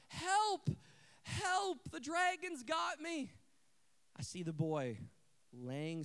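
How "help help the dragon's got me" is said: high mock damsel call for help; imitated girl's cry. Girl back up the tower, imitated high cry for help. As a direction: play a little girl trapped by a dragon. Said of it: "as the words are coming out of her mouth".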